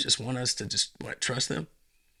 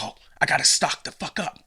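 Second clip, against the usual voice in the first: panicky hoarder; 6 semitones higher, 6 dB louder, faster than usual. Retold or imitated. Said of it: "everybody's just like".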